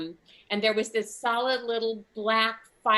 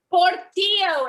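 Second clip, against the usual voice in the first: Portillo said proudly, much higher and louder; 11 semitones higher, 8 dB louder, slower than usual. Retold or imitated. Comic tells painters her puppy's name, proudly. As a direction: announce Portillo like a proud mom. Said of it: "And so proudly I said".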